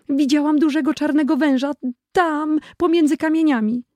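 put-on high, scared little girl. Little sister telling her brother about the snake, high and scared. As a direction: speak as a frightened small girl.

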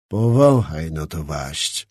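icy calm threat under his breath; coldly. A narrator's reading. Skrzetuski holds the hilt under Czapliński's nose, cold low threat. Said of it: "rzekł zimno".

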